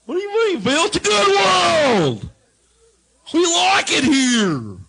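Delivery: high, loud, slow indignant yell; hammed-up crowd shouting at the comic. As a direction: bellow like an offended herd. Grazers shouting back at comic.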